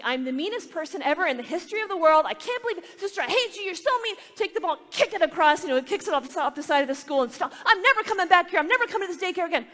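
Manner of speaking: high, loud mock meltdown; hammed-up child's tantrum. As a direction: wail like a furious little kid.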